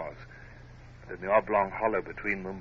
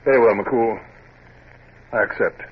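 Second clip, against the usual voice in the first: louder, firm and resolved; firm acceptance; 3 semitones higher, 8 dB louder than usual. Acted. Hornblower to McCool, accepting the condemned man's bargain, firm and loud.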